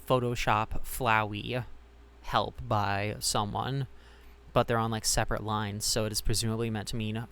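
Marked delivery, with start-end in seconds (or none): 0.1-3.9 reading each word out slowly; slow credits reading. Shown as a demonstration. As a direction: spell out the credits line slowly. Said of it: "it says".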